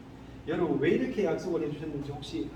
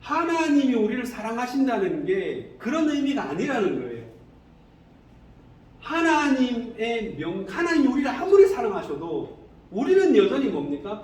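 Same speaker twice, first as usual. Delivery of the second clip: raised, louder sermon voice; emphatic preaching; 6 semitones higher, 7 dB louder than usual. Real, not acted. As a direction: drive each point home to the congregation.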